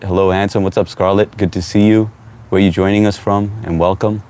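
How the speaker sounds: ordinary talk, nothing marked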